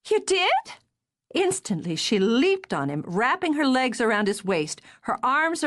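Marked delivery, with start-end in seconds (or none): 0.1-0.6 surprised. Acted. Hears of marriage proposal.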